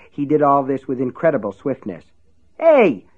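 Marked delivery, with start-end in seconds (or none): 2.6-3.1 high, loud yell. A narrator's reading. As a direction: shout it out sharply. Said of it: "Ace yelled".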